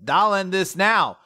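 high, loud heroic declaration; Yuno's battle cry. Retold or imitated. Host voicing Yuno firing his beam, bold battle cry. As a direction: declare it as the finishing blow. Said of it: "wind beam to be like".

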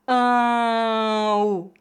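Ão drawn out, strong nasal "ã" gliding into weak "u"; both vowels sounded. Shown as a demonstration. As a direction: stretch Ão slowly so both vowels are heard. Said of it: "devemos pronunciar as duas vogais".

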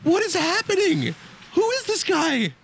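very high, drawn-out teary wail; mock tearful. Uncle tearing up at the game, imitated as tearful. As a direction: imitate someone welling up in bewilderment, slow and high-pitched. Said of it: "He starts tearing up. He's like".

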